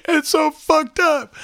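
high, loud, drawn-out mock sob; imitated crying. Retelling how the girlfriend cried after being hit, mock wailing. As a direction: wail it very high and slow, like someone crying.

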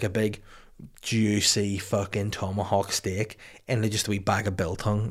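normal talking voice

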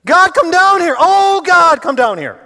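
loud, high pleading cry to God; desperate cry. Acted. Preacher voicing the prayer as a loud desperate plea. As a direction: beg God to come down, full voice. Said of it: "So let me paraphrase that".

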